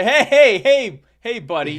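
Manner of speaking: high, loud string of heys; imitated high, insistent call; mock urgent interjection. Acts out someone's urgent interjection.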